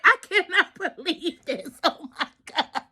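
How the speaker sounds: imitating a cackling doctor; words broken up by cackles; hysterical laughing. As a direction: barely get it out through mock hysterical laughter. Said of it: "hysterically laughing, she was cackling".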